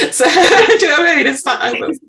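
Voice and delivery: words breaking up in real laughter; laughing. Real, not acted. Host laughing at her own odd habit. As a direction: laugh through it, pitch jumping up. Laughing about the call-ending rivalry.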